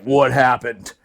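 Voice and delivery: low-voiced officer asking what happened; lieutenant. Lieutenant jumps out of the truck to the blast, low voice. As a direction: drop low for the arriving lieutenant. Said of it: "The lieutenant shows up".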